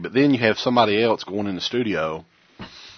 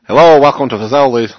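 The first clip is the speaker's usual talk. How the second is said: loud, higher restaurant welcome; counter worker greeting; 5 semitones higher, 9 dB louder than usual. Acted. Restaurant worker welcoming a customer, loud greeting. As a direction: call out a chipper staff greeting.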